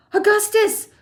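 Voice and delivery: high loud call across. A narrator's reading. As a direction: call him back loudly. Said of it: "called out Missus Gloop".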